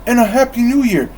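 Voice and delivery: high, fast cheerful call. Acted. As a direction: fling it back on the way out. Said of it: "Fred called".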